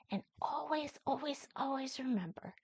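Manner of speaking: soft whispered reminder. Acted. Nana whispers a reminder to her viewers. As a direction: hush it like a promise.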